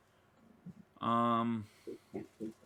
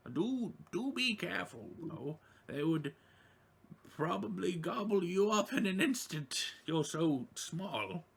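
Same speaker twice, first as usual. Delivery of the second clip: raised, fussy townsfolk voice; high NPC voice; 7 semitones higher than usual. Acted. NPC warning the small adventurer, higher-voiced and fussing. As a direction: warn them kindly from up high. NPC warns the tiny party member.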